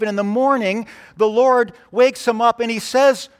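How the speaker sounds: emphatic pulpit narration; forceful preaching. Real, not acted. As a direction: drive the story on in a high, strong preacher's voice.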